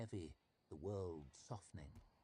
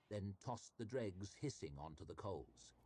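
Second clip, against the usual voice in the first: ordinary delivery, nothing marked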